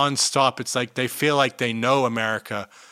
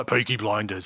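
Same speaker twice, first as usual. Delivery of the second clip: put-on Brummie accent, "Poiky Bloinders"; broad Brummie vowels in "Blinders"; Birmingham accent; 3 semitones lower, 4 dB quieter than usual. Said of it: "I like a Birmingham accent".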